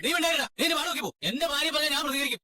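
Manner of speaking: high-pitched angry shutting-down. Acted. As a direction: silence him, standing up for your wife. Silencing brother during the quarrel. (ദേഷ്യത്തോടെ വായടപ്പിക്കുന്നു)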